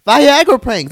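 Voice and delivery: high, loud outraged repeat; outraged shout. Real, not acted. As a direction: shout it out in disbelief. Ranting about prank videos.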